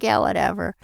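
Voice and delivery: a lightly voiced bored brush-off; dismissive husband. Husband brushing off wife's idea.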